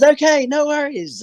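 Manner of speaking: put-on high, breezy French accent; lightly voiced French guy shrugging it off; a hint of a French accent. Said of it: "And he goes".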